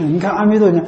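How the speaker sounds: ordinary talk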